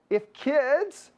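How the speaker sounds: put-on slow German Canadian farm accent. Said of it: "my, um, German Canadian farm accent".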